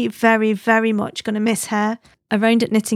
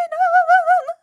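very high yappy squeaks of glee; squeaky puppy yips; excited puppy yelps. Retold or imitated. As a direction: yip like an overjoyed spaniel pup. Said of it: "Like some kind of demented spaniel puppy".

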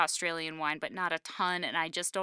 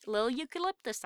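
put-on New Zealand accent; 8 semitones higher than usual. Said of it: "do my horrible New Zealand accent".

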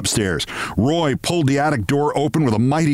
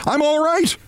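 high yell to reassure; reassuring yell; 13 semitones higher than usual. A narrator's reading. Briggs yelling to reassure the mother, high yell. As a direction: yell it out to calm her. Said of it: "Briggs yelled to reassure her".

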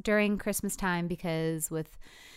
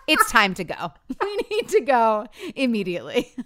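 laughing urgency; 4 semitones higher, 7 dB louder than usual. Retold or imitated. Wife urgently wants to leave.

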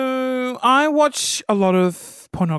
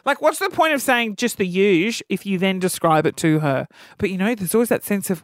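ordinary talk

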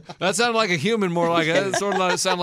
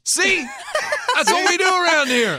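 laughing; 9 semitones higher than usual. Real, not acted. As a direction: laugh through the words. Laughing after the chest punch.

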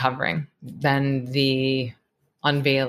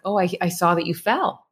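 calm parent; 4 semitones higher than usual. Retold or imitated. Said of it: "versus, like".

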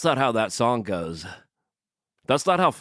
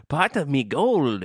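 slow, high stage-Irish; drawn-out fake Irish leprechaun talk; mock Irish accent; 4 semitones higher than usual. Podcaster mocking listener's Ireland, slow mock Irish accent. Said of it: "our horrible appropriation of their culture".

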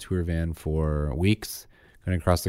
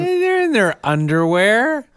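incredulous protest; 6 dB louder, slower than usual. Real, not acted. Teasing objection to wife's remark.

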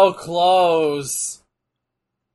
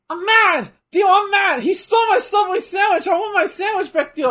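put-on higher, louder childish yelling; mock tantrum; 11 semitones higher, 5 dB louder than usual. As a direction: whine like a furious kid over a sandwich. Voicing Chavo throwing a tantrum.

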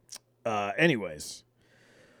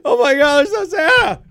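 much higher and louder, a hammed-up crying wail; mock anguished wail; 12 dB louder than usual. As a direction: wail it like a heartbroken kid, over the top. Mock reaction to finding presents.

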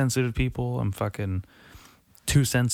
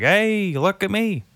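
high, louder mocking show-off; mocking Greta claiming attention; mock attention seeker; 10 semitones higher, 5 dB louder, slower than usual. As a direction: ham up a smug look-at-me. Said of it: "and be like".

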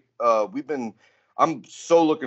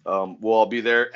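ordinary talk, nothing marked

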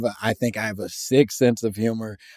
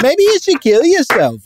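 mock cheerful letter voice; 12 semitones higher, 6 dB louder than usual. Reading Hoover's letter aloud.

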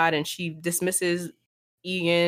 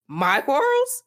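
pitched up high in disbelief; incredulous; 8 semitones higher, slower than usual. A narrator's reading. Catelyn to her sister, high and incredulous. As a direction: repeat it back, stunned. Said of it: "could scarce believe what she was hearing".

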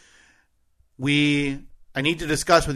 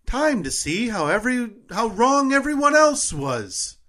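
Melissa Vaughn; 8 semitones higher than usual. Acted. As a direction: lift the pitch and stretch it out like an announcer. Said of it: "do your best Melissa Vaughn impression".

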